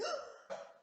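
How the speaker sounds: sharp, breathy gasp of shock; startled gasp. Retold or imitated. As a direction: gasp like the table just flipped.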